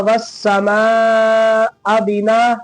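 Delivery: "वस्समा" with the final "मा" held very long; long madd. Shown as a demonstration. Teacher demonstrating madd, very long "मा" in "वस्समा". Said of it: "ढाई अलिफ से ज्यादा फिर खींचेंगे".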